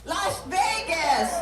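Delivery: amazed at the distance; excited shout. Real, not acted. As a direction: call it out with delight. Echoing an audience answer.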